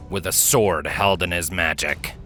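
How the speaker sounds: plain reading voice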